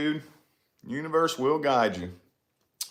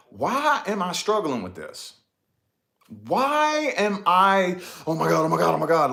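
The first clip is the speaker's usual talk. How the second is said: anxious inner voice; 5 semitones higher than usual. Retold or imitated. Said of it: "I'm panicking".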